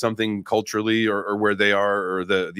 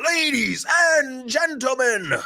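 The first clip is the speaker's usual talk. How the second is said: high, slow, shouted ladies-and-gentlemen; boxing-ring host call; announcer voice; slower than usual. Pretend. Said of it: "I don't wanna keep that up".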